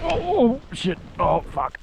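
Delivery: high yelp while falling, then cursing; real shock mid-crash; startled yelp. Real, not acted. Falling in the snow.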